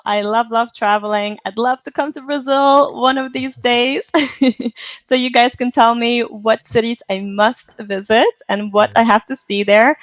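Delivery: ordinary delivery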